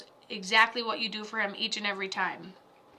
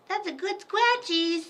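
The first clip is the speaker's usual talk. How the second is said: scratchies in a higher squeaky pet voice; 7 semitones higher than usual. Real, not acted. Cooing scratchies to the cat in a higher voice. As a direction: lift scratchies up into a cooing pitch.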